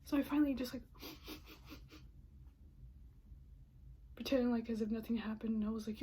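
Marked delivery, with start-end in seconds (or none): 0.9-3.1 a soft wet sniff; tearful sniff. Real, not acted. Pulling herself together before getting home, a tearful sniff. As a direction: sniff back the tears.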